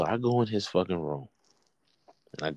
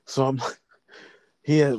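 usual speaking voice

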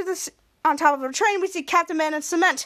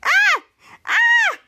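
shrill squeal, much higher and louder; high-pitched scream; 6 dB louder than usual. Retold or imitated. Hero hit by the beam screams high-pitched. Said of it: "It's screaming in a high-pitched voice".

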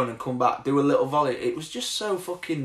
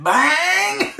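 high, loud catchphrase yell; Terry; 7 dB louder than usual. Retold or imitated. Teammate mimics coach despite sleeping child, loud yell. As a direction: belt it out in a mimicking shout. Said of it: "I can't do it, Harley's in bed".

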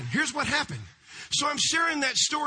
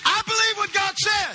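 higher, louder declaration; preaching shout; 9 semitones higher, 5 dB louder than usual. Acted. Pastor proclaims his faith to the congregation, shouting. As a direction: shout your belief to the church.

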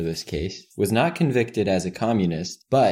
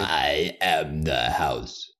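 very slow, lower movie-character voice; Star Wars impression; 5 semitones lower, 4 dB quieter, much slower than usual. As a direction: stretch it out low like a film villain. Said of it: "sick of your impressions".

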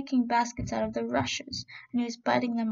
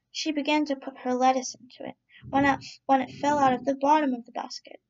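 usual speaking voice

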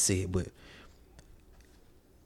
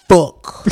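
"fuck" punched out loud; emphasis on fuck; 3 semitones higher, 9 dB louder than usual. Real, not acted. Podcaster stressing his cuss word, loud and punched. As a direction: slam the word "fuck" mid-sentence. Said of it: "I put emphasis on that word".

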